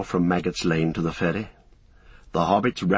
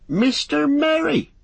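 higher, slow, astonished cry; surprised cry; 4 dB louder, slower than usual. Acted. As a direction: cry out in relief. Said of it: "he cried".

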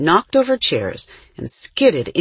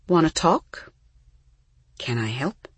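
gentle concern. A narrator's reading. Mother comforting her shaking daughter, gently. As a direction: offer help tenderly, without pressing.

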